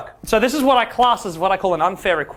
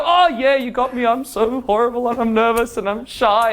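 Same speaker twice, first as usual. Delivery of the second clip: self-pitying confession; 2 semitones higher than usual. Shown as a demonstration. Man caught learning game confesses to a woman, self-pitying. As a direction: cave in as if caught out, mock pitiful. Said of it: "it's how you phrase it. If you go".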